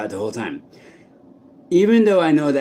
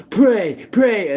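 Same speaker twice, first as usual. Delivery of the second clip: mocking the voices; 8 semitones higher than usual. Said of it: "they'll say".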